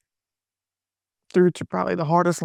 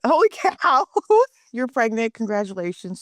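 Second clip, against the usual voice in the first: high, excited burst of disbelief; excited shriek; 3 semitones higher than usual. Retold or imitated. Coach reacting to pregnancy news, thrilled high squeal. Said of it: "Just super excited".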